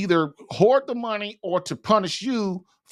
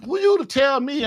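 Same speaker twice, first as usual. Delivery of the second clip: mock indignant retort, higher pitched; indignant comeback; 6 semitones higher than usual. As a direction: imitate an offended wife talking back. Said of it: "they're gonna be like".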